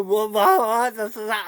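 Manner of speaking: normal talking voice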